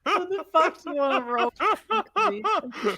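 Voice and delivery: high-pitched, incredulous question; incredulous game master, voice jumping up; laughing disbelief. Real, not acted. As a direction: ask it in amused, baffled disbelief. Baffled reaction to player's choice.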